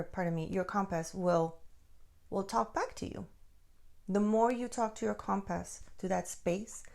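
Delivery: ordinary talk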